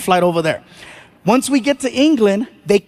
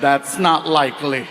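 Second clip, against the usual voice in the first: deadpan; 6 semitones lower than usual. Retold or imitated. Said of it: "I'm like".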